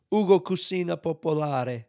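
showy Italian flourish on the restaurant name; Italian accent. Shown as a demonstration. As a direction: say Ugo Cucina Popolare like an Italian, tapped R.

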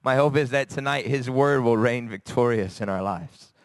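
ordinary delivery

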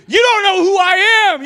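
high, loud offended outburst; mock indignant. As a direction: bristle at being insulted on the road. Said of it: "I'm thinking".

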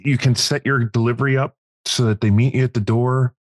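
ordinary delivery

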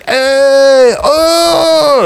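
high, loud whooping shout; mosh pit yell. Retold or imitated. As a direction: yell like a rowdy kid at a show. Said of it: "a bunch of fucking kids just like".

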